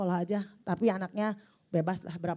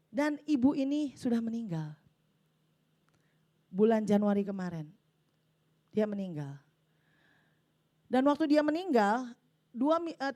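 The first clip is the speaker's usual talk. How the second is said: normal talking voice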